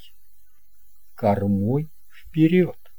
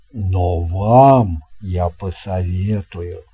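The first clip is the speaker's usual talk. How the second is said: lower, slow, grand sea captain; captain's voice; 4 semitones lower, 3 dB louder, slower than usual. Acted. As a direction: slowly talk up the best cabin with a wink. Captain offers cabins. (неторопливый низкий голос капитана)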